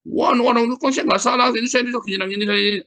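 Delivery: high, loud imitation of a raging man; mock furious rant. Preacher imitating the apologist raging at him, mock furious. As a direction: squeal out his outrage, hammed up. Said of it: "Ini orang ngamuk, ngamuk".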